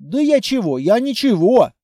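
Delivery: hasty-sounding innocent protest; flustered backpedalling. A narrator's reading. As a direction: back off with mild fluster. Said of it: "забормотал Петрович".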